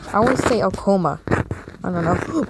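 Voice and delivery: ordinary delivery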